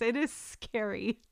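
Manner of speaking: usual speaking voice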